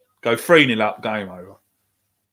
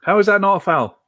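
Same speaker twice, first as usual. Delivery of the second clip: pitched high, indignant question at the referee; outraged disbelief; 7 semitones higher than usual. Real, not acted. Watching his team, he protests the foul call in high outrage. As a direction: fan yelling at the screen over a missed foul, raised and indignant.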